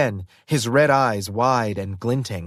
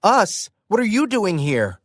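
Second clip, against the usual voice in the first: higher, indignant shout thrown back; sharp retort; 9 semitones higher than usual. Acted. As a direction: fire the question right back. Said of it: "I shot back".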